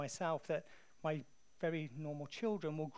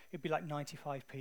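ordinary talk, nothing marked

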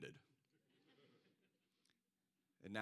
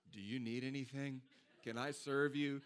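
mock eager servant; 5 semitones higher, 5 dB louder than usual. Offering the friend ice after his procedure, lightly mock eager. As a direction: offer help like an overeager nurse, half-laughing. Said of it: "I'm like".

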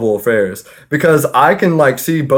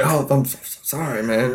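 quiet apology; 8 dB quieter than usual. Retold or imitated. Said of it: "I was like".

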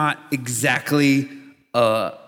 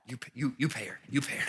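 muttering; 5 semitones lower, 12 dB quieter, faster than usual. Acted. Saul hushes and deflects the payment.

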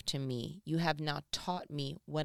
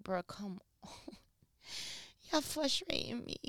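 mock exasperation; 5 semitones higher, 6 dB quieter than usual. Imitating Jesus exasperated.

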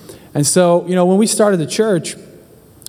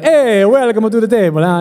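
a jovial Italian host at the table; Italian accent; 5 semitones higher than usual. Retold or imitated. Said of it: "No, God's gonna be like".

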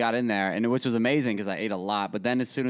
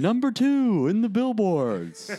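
mock announcer; 9 semitones higher, 3 dB louder, slower than usual.

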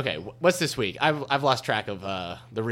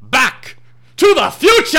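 triumphant shout; 13 dB louder, slower than usual. Acted. Triumphant departure in the sketch.

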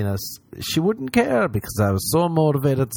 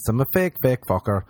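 normal talking voice

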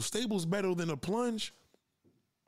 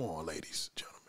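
whisper; 13 semitones lower, 7 dB quieter than usual. Real, not acted. Aside to the audience.

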